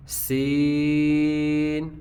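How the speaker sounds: سین held slowly, light with a whistling s; thin seen. Shown as a demonstration. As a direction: stretch سین thin and hissing. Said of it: "sound باریک ہوگی".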